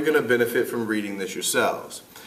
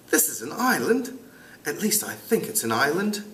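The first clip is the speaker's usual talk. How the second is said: lightly voiced British schoolboy, raised pitch; amateur British accent, a bit higher; 5 semitones higher, 4 dB quieter than usual. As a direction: try a British boy's voice. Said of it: "try to do it with a British accent".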